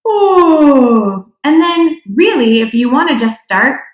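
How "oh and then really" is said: higher, open yawning sound; a demo of a yawn with voice. Shown as a demonstration. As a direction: start making noise as you yawn. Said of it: "letting that soft palate kind of raise and lift".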